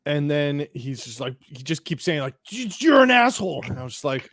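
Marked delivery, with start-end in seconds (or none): 2.5-3.6 high mock-furious stuttered insult; angry stammering. Man keeps insulting narrator, high stammering mock rage. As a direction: stumble on the first word, then burst out high. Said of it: "stammering gibberish".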